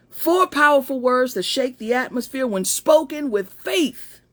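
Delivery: measured motivational oration, punching key words; fervent pep-talk reading. Acted. Reading a pep talk to followers, fervent and emphatic.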